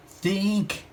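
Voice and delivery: high, excited brain blast; Jimmy struck by an idea, excited high cry; sudden cry. A narrator's reading. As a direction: burst out with the idea. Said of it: "Jimmy suddenly cried".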